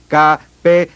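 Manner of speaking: ordinary talk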